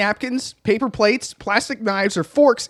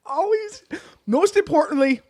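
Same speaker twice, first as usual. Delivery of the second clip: laughter-strained; 7 semitones higher than usual. Real, not acted. Resumes tip after laughing fit.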